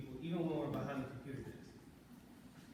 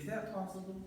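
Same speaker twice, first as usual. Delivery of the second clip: "possible" asked on a raised, positive pitch; higher, undemanding question tune on "possible"; upbeat asking inflection; 4 semitones higher than usual. Shown as a demonstration. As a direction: lift "is that possible" as a polite request. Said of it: "Did anybody hear the intonality".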